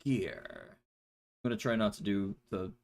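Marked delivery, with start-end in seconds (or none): 0.0-0.8 much lower, gruff soldier growl; low tough-guy delivery of one word; Solid Snake voice. Retold or imitated. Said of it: "naked, uh, Solid Snake voice too frequently".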